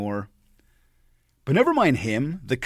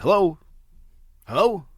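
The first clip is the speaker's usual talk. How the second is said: higher, louder questioning hello; startled phone hello; 7 semitones higher, 6 dB louder than usual. Retold or imitated. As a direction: mimic a caller suddenly thrown, calling down the line. Caller caught out on phone.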